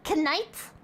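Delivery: "Knight" with the K pronounced before the N; sounded K. Shown as a demonstration. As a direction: say KNIGHT as kuh-NIGHT, questioning. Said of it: "The K in night originally wasn't silent".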